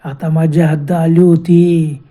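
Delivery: slow, loud, drawn-out ghostly menace; hoarse ghost voice. Acted. Ghost calls after fleeing friends, slow hoarse menacing voice. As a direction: drag out each word like a menacing ghost. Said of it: "याचा घोघरा आवाज".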